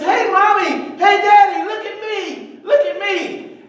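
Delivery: put-on high, eager child calling out; excited little boy. Boy on the diving board calls his parents, high and eager. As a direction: call out like a proud five-year-old.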